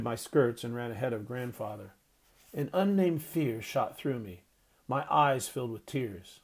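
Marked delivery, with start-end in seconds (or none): none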